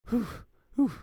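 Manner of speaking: out of breath from running; panting from running. Acted. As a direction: pant hard.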